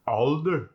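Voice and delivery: "alder." as "awlder"; Scottish aw for O. Shown as a demonstration. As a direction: say older with aw for "alder.". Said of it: "or aw".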